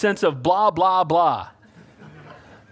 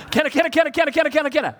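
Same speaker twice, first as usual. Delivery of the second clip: put-on nagging child; 5 semitones higher, much faster than usual.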